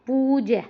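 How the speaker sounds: पूज्य as drawn-out pooo-je; mistaken long vowel. Shown as a demonstration. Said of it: "ये हमारा पूज्य नहीं".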